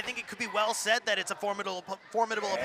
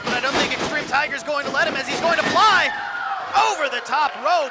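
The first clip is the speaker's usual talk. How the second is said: excited commentary; 3 semitones higher, 7 dB louder than usual. Acted. Color commentary building.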